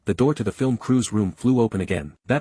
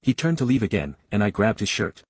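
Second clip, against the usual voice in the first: ordinary delivery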